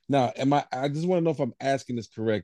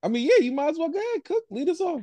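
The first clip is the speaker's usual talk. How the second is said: excited; faster than usual. Real, not acted. Teasing James to go first.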